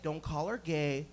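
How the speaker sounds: low, soft, slow self-warning; inner voice. Acted. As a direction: caution yourself quietly and slowly. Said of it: "in my head, I'm like".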